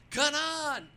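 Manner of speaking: loud, high announcer call, drawn out slowly; race call. Retold or imitated. Booth announcer calls Kanaan's position to the grandstands, loud and slow. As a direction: boom the name and position over the PA.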